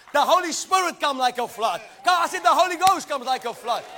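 full-force shouting preacher; preaching shout. Real, not acted. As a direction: thunder it out to the congregation.

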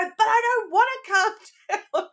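very high mock-tantrum kid voice; high, laughing imitation of a child's refusal; imitated protesting child. Acts little son refusing to calm down.

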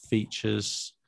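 normal talking voice

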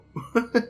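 high laugh. Real, not acted.

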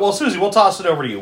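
ordinary talk

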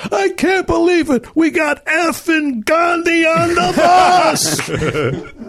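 high, slow laughing shout of disbelief; flips out. Retold or imitated. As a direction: blurt it out, losing it with laughter. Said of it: "Pankow just flips out and goes".

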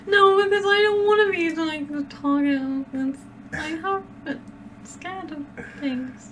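high, frightened pleading voice; anxious and high-pitched; scared. Real, not acted. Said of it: "I'm really terrified now".

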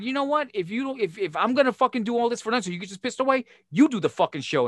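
high, fast angry outburst. Retold or imitated. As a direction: throw it back in his face. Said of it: "I said".

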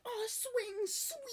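high airy gushing over the swing; dreamy squeal. Retold or imitated. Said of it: "She's like".

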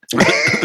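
squealing laugh pitched far up; a delighted high cackle; high laughter. Real, not acted. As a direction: break into giggles. Laughing at dream scene.

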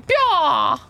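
high loud Pyaw shout; Chappelle noise imitation. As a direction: yell Pyaw mocking the game. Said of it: "Dave Chappelle making fun of it".

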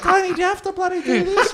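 very high mock weary mum; hammed-up pleading mother; exasperated mum voice.

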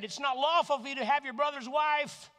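loud, high rebuking cry; John the Baptist rebuking the king, loud; prophet's rebuke. Acted. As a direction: call it out like a prophet. John rebuking Herod in scripture.

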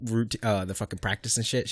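ordinary talk

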